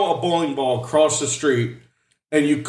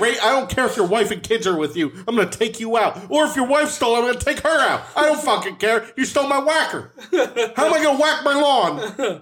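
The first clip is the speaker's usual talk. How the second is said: mock threat; 8 semitones higher than usual. Mock threat to weed whacker thief.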